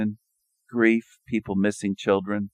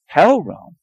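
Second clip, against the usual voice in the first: mock dramatic; 9 semitones higher, 7 dB louder than usual. Recurring dramatic catchphrase.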